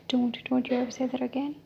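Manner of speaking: plain reading voice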